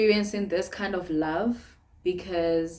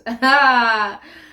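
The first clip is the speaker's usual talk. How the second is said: loud laugh; 3 semitones higher, 10 dB louder than usual. Real, not acted. Vlogger laughing about her relationship status, loud laugh. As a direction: burst of laughter.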